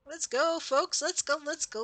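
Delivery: high-pitched, repeated urging; rally the folks along in a high voice; excited rallying. Real, not acted. Player urging folks onward in the raid, excited high rallying. Rallies viewers after cheering.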